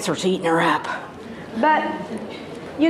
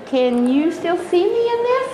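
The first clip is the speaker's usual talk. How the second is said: higher, fretful mock shopper voice; mock skinny shopper; 7 semitones higher than usual. Skinny women at T J Maxx, high mocking voice. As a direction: squeak it out like a tiny woman. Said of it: "and they will say".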